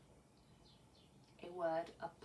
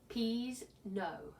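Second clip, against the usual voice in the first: ordinary delivery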